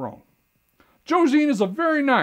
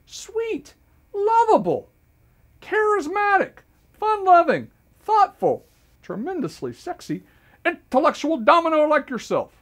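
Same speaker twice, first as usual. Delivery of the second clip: mock indignant; 7 semitones higher, slower than usual. Mock outraged self-praise.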